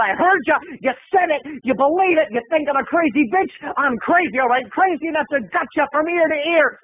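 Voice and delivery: high, insistent crazy-lady rant; hammed-up accusing madwoman; crazy duck lady. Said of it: "She said".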